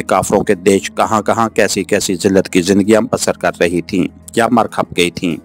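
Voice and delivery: ordinary delivery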